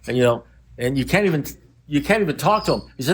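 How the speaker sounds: ordinary delivery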